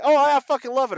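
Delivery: excited reaction. Pretend. Quoting own video reactions.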